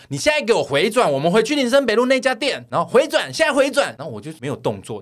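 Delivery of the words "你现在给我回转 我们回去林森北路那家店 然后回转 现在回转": high, loud mock nagging order; shrill-free high loud insisting; bossy demand. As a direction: bark the U-turn order like a pushy passenger. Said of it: "那个女生就一直在后面说".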